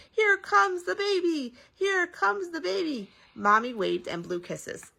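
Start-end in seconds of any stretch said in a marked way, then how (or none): 0.1-3.1 high, slow joyful call; shouted announcement. A narrator's reading. Nurses announcing the baby, high slow call. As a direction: call it down the hall. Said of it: "doctors and nurses shouted".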